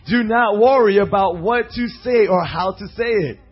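loud, forceful preaching proclamation; scripture reading. Acted. Preacher tells believers not to worry, forceful scripture reading. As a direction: hammer out the verse to the room. Reads scripture aloud to congregation.

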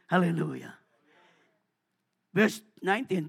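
usual speaking voice

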